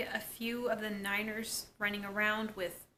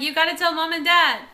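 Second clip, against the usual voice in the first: high, loud sisterly demand; mock urgent demand; 7 semitones higher, 11 dB louder than usual. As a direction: act out a sister insisting at full volume. Acted sisters' quarrel.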